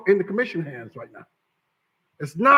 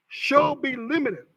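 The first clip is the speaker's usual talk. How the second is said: "shall" drawn out slowly and pitched up; stress on shall; 4 semitones higher, slower than usual. Real, not acted. Lawyer drives home "shall" to the commission, slow and emphatic. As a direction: lean hard on "shall", slowly. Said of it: "Let me, let me emphasize the word shall".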